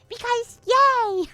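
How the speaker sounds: very high, squeaky cheer; high-pitched playful yay; mock cheer.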